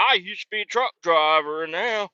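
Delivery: high, put-on old country man voice; squeaky high old-timer bragging about TikTok; old man in the boonies. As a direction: ham up the rambling old-timer. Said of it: "He's an old man in the boonies".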